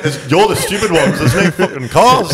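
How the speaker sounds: high, exasperated protest through laughter; mock indignant retort. Scooter rider answering the tutting crowd, laughing indignation. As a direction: protest in comic disbelief, pitch way up.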